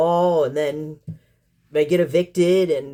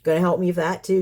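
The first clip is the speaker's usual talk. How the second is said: normal talking voice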